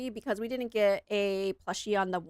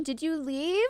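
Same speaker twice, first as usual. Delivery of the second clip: higher-pitched, drawn-out plea; dismayed protest; 9 semitones higher, 3 dB louder, slower than usual. Real, not acted. Streamer to someone who left the server, dismayed protest. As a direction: protest in a raised pitch, dragging out the don'ts.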